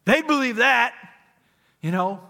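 high, very loud outburst; acted insistent shout. Rich man arguing with Abraham for his brothers, insistent shout. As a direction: burst out, desperate to persuade.

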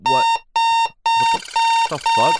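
sudden high startled yelp; shocked outburst. Acted. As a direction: blurt it out in disbelief. Computer suddenly hacked on air.

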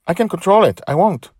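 high, loud, insistent answer; high-pitched earnest assurance; eager character reply. Acted. Joy answers Charlie in the story.

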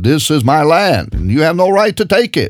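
high, fast mock indignant claim; mock claimant. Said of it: "they all claim".

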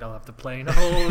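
loud chatterbox making up her mind; hammed-up dithering. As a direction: blurt the order out theatrically. Acted chatterbox ordering chocolate, praised as reenactment.

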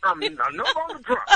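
usual speaking voice

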